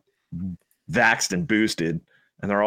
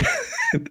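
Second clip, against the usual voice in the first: high laugh; 3 dB quieter than usual. Real, not acted. Laughs at clip.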